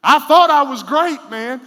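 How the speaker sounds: high, loud mock boast; loud self-mockery. Real, not acted. Preacher mocking his teenage self, loud. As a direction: boast loudly at yourself.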